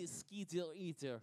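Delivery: mock Russian accent; hammy Russian accent. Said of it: "Say Skeeter Eaters in a Russian accent".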